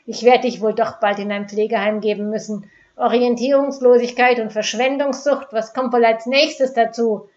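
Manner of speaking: loud mocking contempt; barking scorn. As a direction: sneer it out loudly. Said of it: "bellte Dieter".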